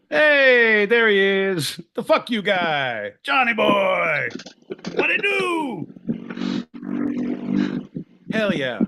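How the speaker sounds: high, drawn-out shouts of welcome; big rowdy hail to a buddy; excited hollered greeting. Real, not acted. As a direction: holler a hello, stretching each phrase out. Hailing an arriving listener.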